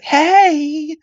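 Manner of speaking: high-pitched flirty greeting; high, drawn-out mock come-on; imitated attractive woman. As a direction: mimic a flirty sitcom woman. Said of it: "then a woman, an attractive woman".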